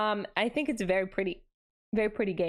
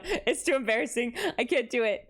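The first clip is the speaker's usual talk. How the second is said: ordinary delivery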